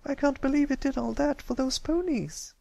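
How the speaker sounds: happy chime. Acted. Sweetabelle chimes in about the hero, happy. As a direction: chime in happily like a little girl.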